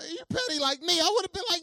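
high, loud preaching. Acted. Pastor driving home the irony to the congregation, full-force preaching.

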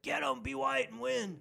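root for the boxer like a crude fan; mock fan cheer. Imitating football crowd backing a boxer, mock cheer.

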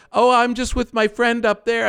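high, breezy mock excuse; fake innocent excuse. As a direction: imitate a line-cutter playing innocent. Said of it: "Another bullshit thing".

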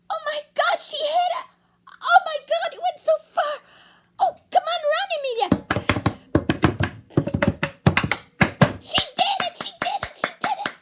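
high, loud, laughing shouts; excited cheering. Acted. Narrator cheering Amelia's home run, high and loud. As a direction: cheer her home like a thrilled fan.

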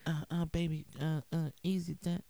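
high, soft mock warning; imitating her warning. Husband imitating his wife calming him, high and soft. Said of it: "You put your hand up like".

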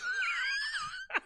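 very high shrieking laughter. Real, not acted. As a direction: let out a squealing laugh. Gargalhada alta. (gargalhada aguda)

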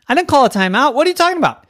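high, loud, incredulous objection; indignant protest. Acted. Coach disputes the ref's call, indignant. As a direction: argue loudly with the official.

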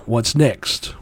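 normal talking voice

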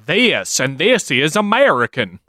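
slow, drawn-out fake American accent; bad American accent. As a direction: imitate a foreigner overdoing an American accent. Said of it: "people try to do an American accent".

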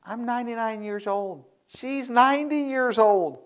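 high, hushed disbelief; incredulous, amused Abraham. Acted. Abraham protesting his and his wife's age, comic disbelief. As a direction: squeak it softly, slowing on the second line. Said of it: "he finds this rather comical".

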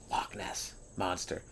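slow, hushed whisper of the name. Acted. Comic whispering the monster's name slowly in mock awe.

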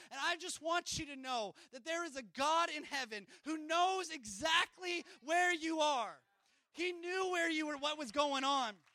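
loud, rising preacher's cry; full-force preaching. Acted. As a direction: preach it out to the whole church.

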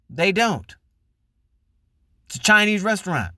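ordinary delivery

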